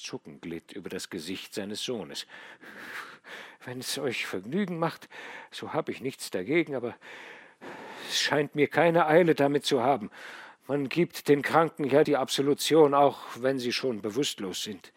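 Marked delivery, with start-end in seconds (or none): 3.6-14.9 higher and louder, coolly ironic; dry dying son. A narrator's reading. Dying son answering his father's plea about absolution, dryly. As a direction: shrug it off with weary irony.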